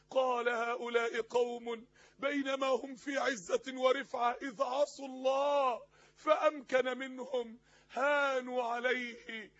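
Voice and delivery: slow, drawn-out declamation; impassioned preaching. Acted. Preacher retelling Abu Darda's lament at the conquest, solemn and impassioned. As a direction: ring out each phrase with long held vowels.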